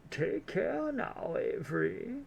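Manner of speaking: drawn-out elderly lady, put on thick; grandma voice. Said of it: "my grandma voice".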